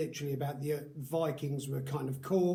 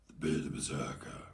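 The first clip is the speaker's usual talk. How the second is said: whispering; 6 dB quieter than usual. Acted. Whispered signature name in sign-off.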